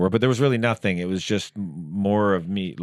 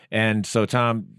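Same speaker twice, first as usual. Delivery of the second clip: normal talking voice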